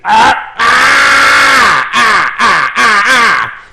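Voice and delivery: loud, high repeated yelps of pain. Retold or imitated. Patient yelping while the nurse hunts for blood, loud and pained. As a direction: yelp every time the needle digs. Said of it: "I'm going".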